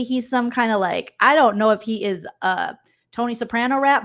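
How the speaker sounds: ordinary delivery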